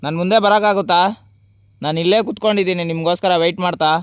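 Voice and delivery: shouting into the dark, high and loud; loud calling out. Real, not acted. Ghost hunter alone at night calls loudly to the spirits. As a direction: call out loudly to unseen spirits.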